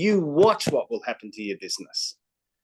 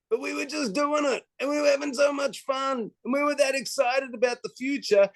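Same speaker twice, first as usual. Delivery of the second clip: thrilled; 11 semitones higher than usual. Real, not acted. Reliving the early days with Kim, bursting with excitement.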